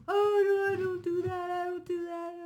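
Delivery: high put-on denying voice; mock denial. Mocking someone setting the record straight, high fake denial. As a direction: play innocent in a high voice. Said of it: "set the record straight".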